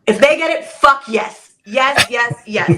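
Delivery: loud, high, joyful yes yes yes; gleeful cheer. Shown as a demonstration. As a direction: cheer them on at full voice. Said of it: "am I allowed to curse?".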